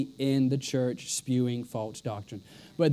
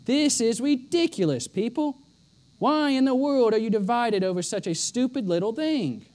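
higher, exasperated Paul; frustrated Paul; 5 semitones higher than usual. Retold or imitated. Preacher voicing Paul scolding the church, higher and frustrated. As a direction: let the frustration ring out, pitched up. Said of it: "you can hear the frustration".

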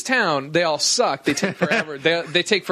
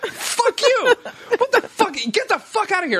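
much higher, strained mock shout back; re-enacted furious yelling. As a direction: yell back in a re-enacted street fight. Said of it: "yelling at a cabbie".

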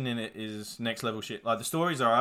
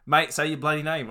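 loud, higher imitation of Aussie talk; Aussie accent; 5 semitones higher, 8 dB louder than usual. Quoting the film hero to a kid he's filming, loud Aussie. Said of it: "he will say Aussie things".

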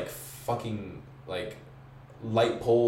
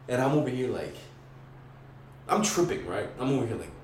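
normal talking voice

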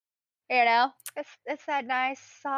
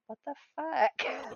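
disgusted disbelief; 5 semitones higher, 5 dB quieter, slower than usual. Retold or imitated. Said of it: "You're just like".

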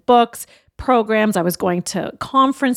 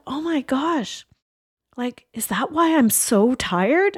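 retold hushed, slow dawning thought; stunned realization; 4 semitones higher, slower than usual.

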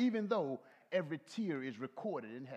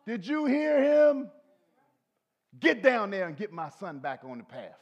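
loud commanding voice of God; God speaking; 4 semitones higher, 12 dB louder than usual. Retold or imitated. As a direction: boom like God calling down. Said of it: "God said".

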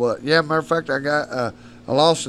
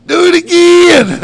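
high, loud, slow cry; stoned buddy; 15 dB louder, slower than usual. Retold or imitated. Stoned friends beg him to jump again, high slow cry. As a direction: crow it out like an amazed stoner.

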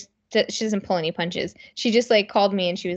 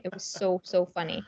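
ordinary talk

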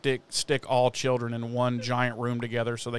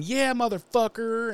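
mock gloating taunt; 10 semitones higher than usual. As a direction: rub it in like a triumphant taunt. Buffy gloating through the door.

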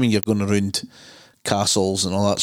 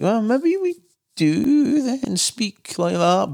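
slow musing; 12 semitones higher, much slower than usual. Retold or imitated. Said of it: "we were like".

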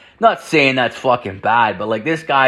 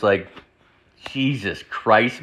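ordinary talk

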